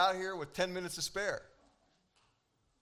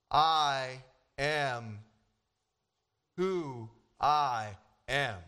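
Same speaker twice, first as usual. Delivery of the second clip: lower, slow, resonant; God answering Moses, lower and slow; 6 semitones lower, 4 dB louder, much slower than usual. Acted. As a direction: intone slowly like God speaking. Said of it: "a voice from God says".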